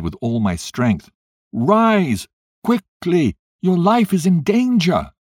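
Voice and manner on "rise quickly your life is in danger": loud, high urgent warning; urgent shout. A narrator's reading. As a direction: shout to wake him from danger. Ghost urging him out of fire.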